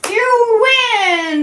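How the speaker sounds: high, loud game-show cheer; you win, shouted with glee; excited congratulations. Real, not acted. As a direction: cheer them on like a quiz host. Congratulating a correct chat answer.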